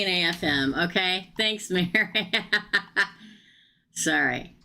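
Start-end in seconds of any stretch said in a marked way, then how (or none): 1.9-3.1 recurring bubbling giggle; laughter breaking out despite yourself; can't quit laughing. Real, not acted. Reader thanking Mary, breaks into uncontrollable laughter. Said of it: "I can't quit laughing".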